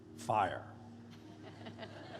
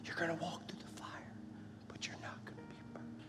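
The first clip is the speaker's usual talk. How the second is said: tender, very soft whisper; God whispering; 4 semitones lower, 20 dB quieter than usual. Acted. God reassuring the scared and anxious, in an intimate whisper. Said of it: "God whispers".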